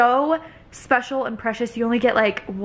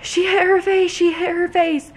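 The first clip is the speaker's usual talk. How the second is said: panicked; 8 semitones higher, 4 dB louder than usual. Retold or imitated. Mom retells the fall in panic.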